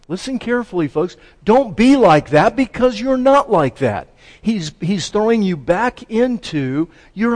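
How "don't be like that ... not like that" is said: loud, high, insistent; forceful preaching at full voice; emphatic exhortation. Acted. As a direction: drive it home to the congregation. Repeats Paul's exhortation for emphasis.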